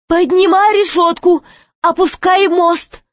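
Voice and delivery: high-pitched guard's call; calling out orders. A narrator's reading. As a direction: send the order up to the gatemen.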